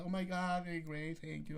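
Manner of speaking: high, soft mock-flattered voice; put-on delighted thanks; flattered woman. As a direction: imitate someone thrilled by a compliment, lightly and high. Acts out complimented woman's delighted reply.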